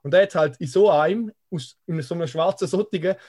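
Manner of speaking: ordinary talk, nothing marked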